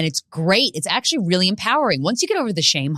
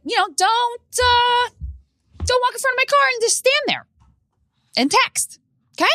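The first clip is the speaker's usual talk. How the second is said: much higher, exasperated scolding; mock scolding. Driver scolding a woman who stopped in the road: high exasperated scold. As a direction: shriek the scolding at the texting pedestrian.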